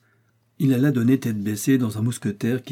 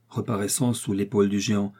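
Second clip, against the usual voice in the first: usual speaking voice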